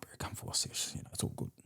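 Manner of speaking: hushed, whispery coaxing. Retold or imitated. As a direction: lure him in softly, almost whispered. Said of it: "coaxed him to come up".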